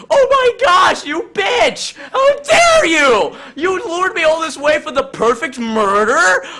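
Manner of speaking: betrayed husband screaming at his scheming wife, outraged; outraged shriek. Acted. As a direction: scream at the betrayal, over the top. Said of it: "And Jonesy's like".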